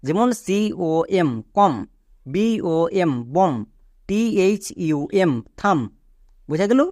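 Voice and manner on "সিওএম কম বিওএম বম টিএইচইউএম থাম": very slow letter-by-letter spelling, then the word. Shown as a demonstration. Teacher spelling words slowly for students. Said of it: "তাই B silent আছে".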